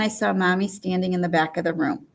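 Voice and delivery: normal talking voice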